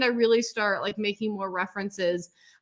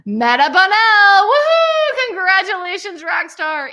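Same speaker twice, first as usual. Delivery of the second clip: much louder and higher, woohoo soaring; excited cheer; 9 semitones higher, 11 dB louder than usual. Real, not acted. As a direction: shout the name out with joy. Announcing graduate name.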